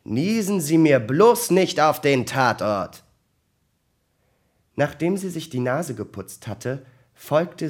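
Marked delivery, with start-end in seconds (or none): 0.0-3.0 loud, higher-pitched grumpy order. A narrator's reading. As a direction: warn them off crossly, with raised voice. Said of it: "sagte wieder mürrisch".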